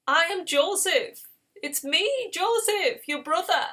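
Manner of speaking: high, loud, emotional cry without sobs; acted impassioned outburst; tearful revelation. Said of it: "he just cries and he says".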